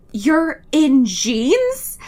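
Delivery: slow, drawn-out disbelief, lightly voiced; mock incredulous Alice. Host playing Alice appalled that Bella wears jeans, slow mock disbelief. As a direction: stretch every word like a let-down stylist. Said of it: "looks over at Bella and is like".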